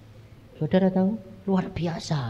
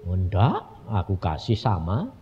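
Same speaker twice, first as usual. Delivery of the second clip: low, softer retold reply; God's calm reassurance; 8 semitones lower, 5 dB quieter than usual. God reassuring the complaining man, low and calm. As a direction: answer low and gently, unruffled.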